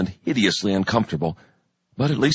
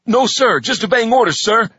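snappy soldier reply; 10 semitones higher, 4 dB louder than usual. A narrator's reading.

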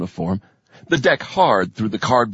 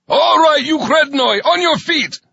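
much higher, louder barked order; roared command; 5 dB louder than usual. A narrator's reading. Sergeant bursts in, roaring at recruits, much higher and louder. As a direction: roar it down the cabin. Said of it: "roared his command".

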